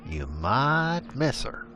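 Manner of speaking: ordinary delivery, nothing marked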